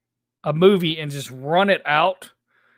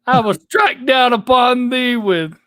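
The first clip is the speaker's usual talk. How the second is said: slow, loud, higher booming Bible verse; Pulp Fiction preacher; 7 semitones higher, 3 dB louder, slower than usual. Retold or imitated. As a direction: shout the verse like a movie hitman. Pulp Fiction quote, Samuel Jackson.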